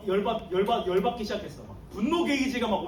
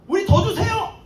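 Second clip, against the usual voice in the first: higher, louder outburst; angry demand; 7 semitones higher, 7 dB louder than usual. Retold or imitated. Workers shouting at the owner for more pay. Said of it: "화를 낸 거예요".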